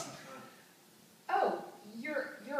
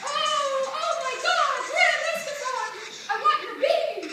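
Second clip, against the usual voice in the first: much higher, ecstatic squealing swoon; over-the-top swooning; 12 semitones higher, 13 dB louder than usual. Acted. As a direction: push the swoon to a frantic peak. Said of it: "I need more orgasm".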